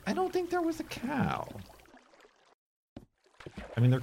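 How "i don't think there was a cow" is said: silly high voice. Pretend.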